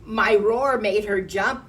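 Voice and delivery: loud, proud boast; boasting lion. A narrator's reading. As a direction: brag loudly about your roar. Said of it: "boasted the lion".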